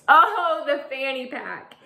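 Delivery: ordinary delivery, nothing marked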